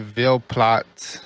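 "Ville Platte" said slowly, VIL PLAT; slow local "Ville Platte" said VIL PLAT; correct pronunciation. Shown as a demonstration. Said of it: "I'm gonna say Via Plate".

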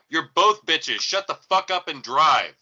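loud, high telling-off; retold shout across traffic; exasperated yell. Bystander scolding two arguing drivers at the lights, exasperated shout.

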